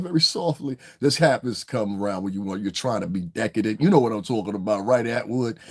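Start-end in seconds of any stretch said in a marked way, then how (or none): none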